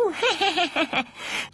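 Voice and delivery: put-on high-pitched baby-doll laugh; gloating high giggle; mocking cackle. New doll laughs at Pino, mocking.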